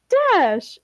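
high-pitched answering call; excited exclamation. Acted. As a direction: call the name back in sudden recognition.

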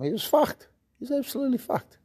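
high, fast and gleeful. Real, not acted. Telling how the tough guy got wrecked, gleeful and high. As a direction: relish how wrecked he was. Gleeful retelling of exhausted newcomer.

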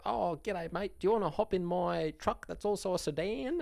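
high, laughing mock Aussie accent; a bad put-on Australian voice, cracking up; rubbish Australian accent. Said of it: "he put on this rubbish Australian accent".

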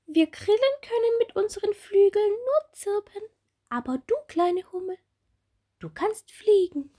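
high, lilting cricket; encouraging cricket voice. Acted. Cricket Gisela encouraging Bommel, high lilting voice. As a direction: cheer the little bee on, sing-song.

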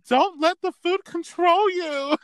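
high, slowed mock mom; mom lecturing. Mom lectures daughter about food, high mock voice. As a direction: preach it in a high mimicking mom voice. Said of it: "she's like, she's like".

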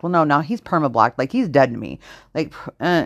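ordinary talk, nothing marked

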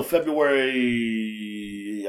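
long, trailing hesitation on the last vowel; unsure, stretched-out word; stalling for recall. Real, not acted. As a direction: draw the word's end way out while thinking. Stalls while recalling the date.

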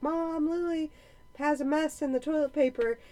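high whiny tattle, imitating a child; mock kid tattling to mom. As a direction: play the little brother telling on his sister.

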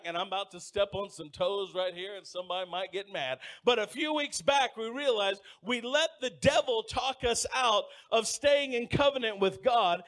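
ordinary talk

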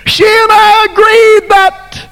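high shouted plea; desperate cry. Acted. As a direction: call up to the king on the wall. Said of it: "She cried out".

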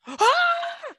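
high, loud mock shriek; sudden acted scream of alarm; imitated scream.

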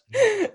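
high burst of laughter; loud laugh. Real, not acted. Laughing after her story.

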